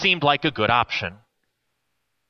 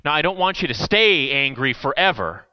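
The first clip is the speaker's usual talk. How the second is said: ordinary talk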